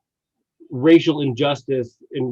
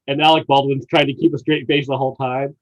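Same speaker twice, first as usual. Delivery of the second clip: ordinary talk, nothing marked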